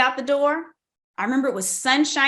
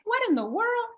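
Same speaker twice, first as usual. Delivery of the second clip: higher, stunned disbelief; surprised; 12 semitones higher than usual. Retold or imitated. Retelling hearing the voice at her desk, higher stunned surprise. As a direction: react as if the voice just spoke to you. Startled by the voice.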